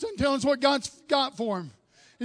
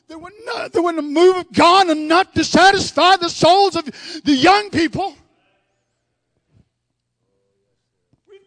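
louder, rising revival preaching; full-force preaching; 3 semitones higher, 8 dB louder than usual. Acted. Preacher urging the church toward revival, full-force preaching. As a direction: preach it hard to the congregation.

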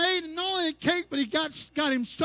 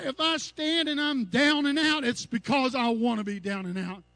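ordinary talk

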